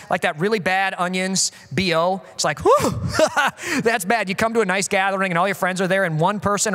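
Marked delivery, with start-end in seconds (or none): 2.6-4.3 imitating a friend recoiling from body odor; mock disgusted laugh. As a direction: react with a laugh to a bad smell. Smelling bad body odor.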